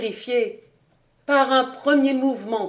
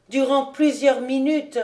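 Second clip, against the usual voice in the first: ordinary delivery, nothing marked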